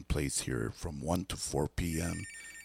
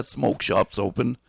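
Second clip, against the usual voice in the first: loud, upbeat radio announcement; bright on-air announcement; radio announcer; 6 semitones higher, 9 dB louder than usual. Acted.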